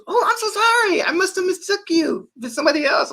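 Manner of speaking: very high, light sorry-sounding voice; mock apologetic guy. The stranger apologizes for mistaking him, high mock voice.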